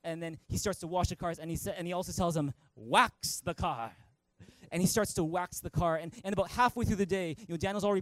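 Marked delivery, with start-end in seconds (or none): 2.8-3.9 low, slow master's instruction; slow mock karate-master voice; Mister Miyagi. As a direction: stretch each word, a little louder, as a teacher's order. Acting Mister Miyagi's command.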